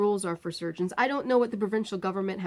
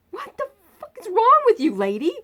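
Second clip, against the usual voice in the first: much higher, loud, exploding; retold yell; 8 dB louder than usual. Patient snapping at the surgeon, retold yell. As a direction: explode at her.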